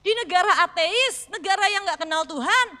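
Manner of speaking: ordinary talk, nothing marked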